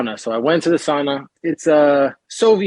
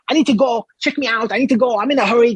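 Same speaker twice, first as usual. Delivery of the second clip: higher-pitched, impatient pestering; insistent repeated demands in a higher voice; hurried hotel guest; 6 semitones higher than usual. Retold or imitated. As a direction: imitate a guest in a rush, pitched up. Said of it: "in a hurry to get out of there".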